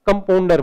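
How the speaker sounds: "कंपाउंडर" with "au" flattened to O: kampondar; mispronounced compounder. Shown as a demonstration. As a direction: turn the middle of "कंपाउंडर" into "pon". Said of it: "बन जाता है". ("कंपाउंडर" को "कंपोंडर" जैसा बोलकर)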